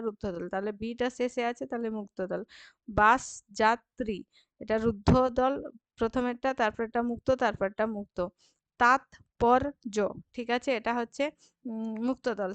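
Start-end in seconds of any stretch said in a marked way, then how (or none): 2.9-4.2 বাস যাত্রী slow and louder, beat by beat; split into syllables. Shown as a demonstration. Teacher dividing the word, slow spaced syllables. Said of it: "শেষ দলগুলির মধ্যে". 8.8-10.0 তাৎপর্য slow and loud, as তাৎ, পর, য; split into syllables. Shown as a demonstration. Teacher isolating the last syllable, slow loud beats. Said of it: "শেষ দলগুলির মধ্যে".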